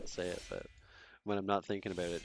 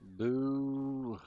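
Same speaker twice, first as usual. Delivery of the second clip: mock boo; 6 semitones higher, 4 dB louder than usual. Streamer boos Horde situation.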